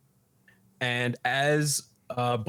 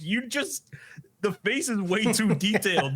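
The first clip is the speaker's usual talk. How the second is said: high-pitched exasperated bickering; exasperated retort; 9 semitones higher than usual. Retold or imitated. Takakura criticizing his partner's robot design, exasperated. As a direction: argue back, pitch jumping up.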